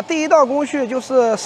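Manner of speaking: usual speaking voice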